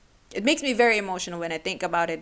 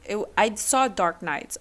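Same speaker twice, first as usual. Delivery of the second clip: ordinary talk, nothing marked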